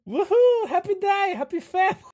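very high, loud whooping; hammed-up celebration in character; gleeful cheering. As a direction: cheer like an overjoyed host. Host character celebrates the free stay.

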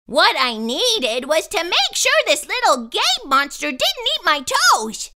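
ordinary delivery

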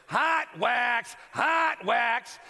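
loud, repeated sign blare; flashing neon sign. Retold or imitated. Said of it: "there's a neon sign that goes".

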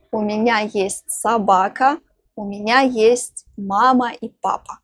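normal talking voice

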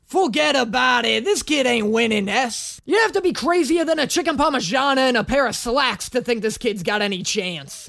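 hammed-up Italian wiseguy accent; broad Italian-American tough talk. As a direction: lay the Italian accent on thick. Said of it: "deeply stereotypical Italian accent".

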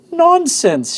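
high, loud outburst; indignant shout. A narrator's reading. Prince scoffing at advice to eat his palace, indignant shout. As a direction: shout it out, outraged. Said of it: "shouted the prince".

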